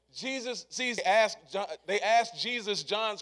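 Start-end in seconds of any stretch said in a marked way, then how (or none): none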